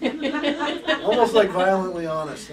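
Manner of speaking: amused loud repeat; laughing. Real, not acted. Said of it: "I don't know how else to put it".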